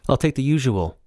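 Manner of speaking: put-on light boy's voice; young boy's voice. A narrator's reading. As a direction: order like a regular. Narrator voices his younger self.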